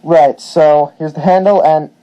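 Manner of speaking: muffled through a mask; talking through a face mask. Real, not acted. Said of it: "sorry if my voice is a little muffled. I was wearing a mask".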